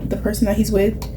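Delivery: ordinary talk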